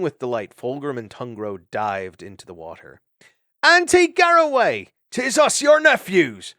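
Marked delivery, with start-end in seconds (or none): 3.6-6.5 joyful call-out. Acted. Nephews calling out in joy.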